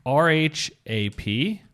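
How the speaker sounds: ordinary talk, nothing marked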